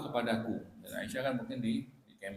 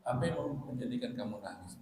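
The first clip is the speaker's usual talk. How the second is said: ordinary talk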